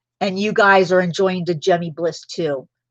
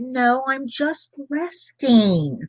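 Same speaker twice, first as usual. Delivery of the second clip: coy innocent; 5 semitones higher, slower than usual. Retold or imitated. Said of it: "I said".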